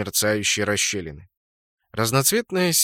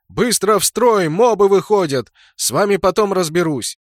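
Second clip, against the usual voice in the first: loud, high battle command; yelled orders; 7 semitones higher, 6 dB louder than usual. A narrator's reading. Clan leader orders subordinates into line, yelling. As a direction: yell furiously at your troops, voice way up. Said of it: "заорал своим подчинённым".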